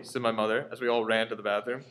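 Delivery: usual speaking voice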